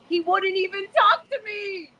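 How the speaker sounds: hurt, fighting back tears; tearful. Real, not acted. Daughter hurt her father ignored her, crying.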